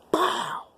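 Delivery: whispered, sharp pow imitating a slap; slap sound effect. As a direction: mimic the crack of a hand on the head. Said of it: "He went".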